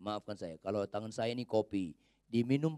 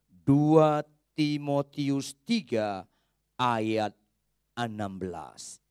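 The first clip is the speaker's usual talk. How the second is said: louder, slow dictation of "Dua Timotius tiga"; slow, spelled-out reference; 6 dB louder, much slower than usual. Shown as a demonstration. Pastor giving the verse reference to the congregation, slow and deliberate. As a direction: give each word time so they can find it. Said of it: "Mari kita buka dua Timotius tiga".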